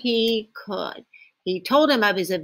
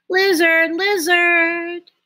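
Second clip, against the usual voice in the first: ordinary delivery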